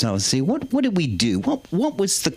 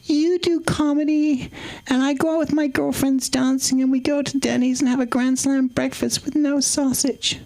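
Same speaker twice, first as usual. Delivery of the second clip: put-on ex-girlfriend's voice; 9 semitones higher than usual. Comedian retelling the breakup call, as his high-voiced ex. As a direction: imitate her in a raised, mannered pitch. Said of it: "She said".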